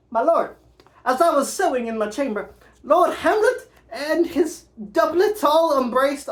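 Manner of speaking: distressed Ophelia. Acted. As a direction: Ophelia rushing to tell her father, upset. Voices Ophelia reporting Hamlet.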